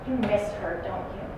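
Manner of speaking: soft, gentle question; tender validation. Acted. Colleague comforts a grieving husband, tender. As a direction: ask it quietly and tenderly.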